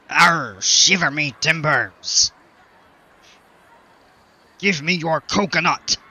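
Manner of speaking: growly hammed-up pirate. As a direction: ham it up like a cartoon pirate. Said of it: "I get pretty stupid with the pirate voice".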